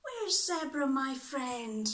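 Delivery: high, loud eager call; crying out. A narrator's reading. Annie calls for her friend Zebra, eager cry. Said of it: "Annie cried".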